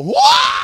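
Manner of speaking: very high, loud mock wail; grandmother's wail. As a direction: let out a shrill grandma howl. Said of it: "lets out a wail".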